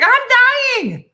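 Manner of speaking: very high, loud panicked wail; panicked cry. Retold or imitated. Allergic friend crying out high and loud. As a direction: wail that you're dying. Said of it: "She was like".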